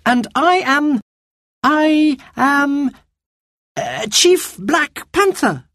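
put-on high girl's voice, slow and hesitant, stalling; a girl inventing a name on the spot; thinking quickly. A narrator's reading. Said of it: "thinking quickly".

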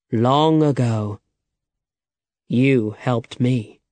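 slow and measured, words drawn out; slow, grave reveal. A narrator's reading. Old man reveals why he helps Alejandro, slow and measured. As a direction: unfold the memory slowly.

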